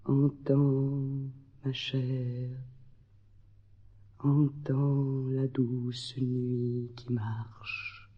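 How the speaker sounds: slow, fading whisper; hushed whisper. Acted. Poet bidding sorrow hear the night, slow whisper. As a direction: let it sink into a drawn-out whisper.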